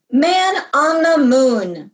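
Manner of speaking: a newsman marvelling at the landing; exclaiming. A narrator's reading.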